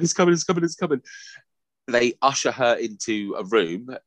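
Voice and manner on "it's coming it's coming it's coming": high, urgent and repeated; a panicky midwife rushing in; mock alarm, pitched up high.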